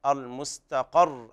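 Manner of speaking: المستقر slow, closing on a held double r; doubled ra stop. Shown as a demonstration. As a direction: stop on المستقر as "mustaqarr", vowelless. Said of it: "الوقف على الراء بالسكون مع التشديد".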